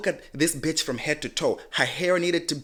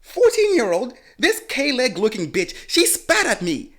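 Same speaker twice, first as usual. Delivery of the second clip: high, loud disbelieving shout; incredulous retort; 11 semitones higher, 5 dB louder, slower than usual. Retold or imitated. Answering the mother, high loud disbelief. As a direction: fire it back in disbelief. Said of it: "And I'm like".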